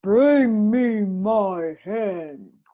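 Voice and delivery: very slow, loud giant order; giant's command. A narrator's reading. Giant ordering his wife, slow and loud. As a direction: drag it out like a lazy giant.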